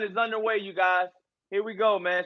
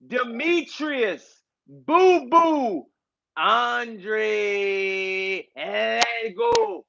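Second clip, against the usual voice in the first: ring announcer intro; 3 semitones higher, slower than usual. Pretend. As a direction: hype up the crowd like the arena MC. Mock ring announcer introducing Andrade.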